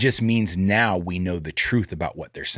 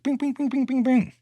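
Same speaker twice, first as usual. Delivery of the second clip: high, playful bing-bing noises like a pinball machine; sing-song bing sounds, way up in pitch. Retold or imitated. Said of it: "And we might be going like".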